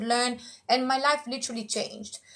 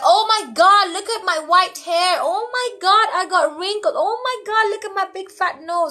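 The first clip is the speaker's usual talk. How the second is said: high, loud, horrified fussing over your own face; mock mirror panic; 8 semitones higher, 6 dB louder than usual. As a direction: shriek in dismay at every flaw. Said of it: "we're like".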